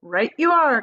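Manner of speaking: hearty cry. A narrator's reading. Wonka congratulating Violet, crying out heartily. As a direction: cheer her on heartily. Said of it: "cried Mister Wonka".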